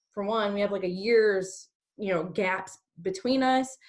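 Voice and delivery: ordinary talk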